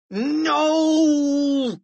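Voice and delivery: long, high scream of no; drawn-out panicked cry; cried out. A narrator's reading. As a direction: wail it in horror. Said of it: "cried George".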